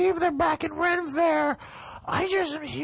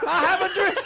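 louder speech-giver voice, laughing; mock orator; 5 semitones higher, 4 dB louder than usual. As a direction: orate it grandly, laughing. Echoing the famous speech.

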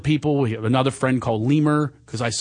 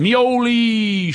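very slow, high sing-song Mioli; drawn-out name call; 8 semitones higher, 4 dB louder than usual. Shown as a demonstration. Addressing his friend the only way he can, slow sing-song. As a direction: stretch the name out like a greeting. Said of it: "that's how I have to address him".

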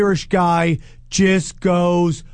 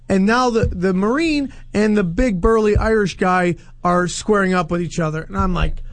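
normal talking voice

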